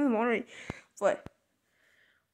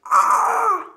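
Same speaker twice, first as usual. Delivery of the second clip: shocked gasp; 7 semitones higher, 4 dB louder than usual. Acted. Sees himself in mirror.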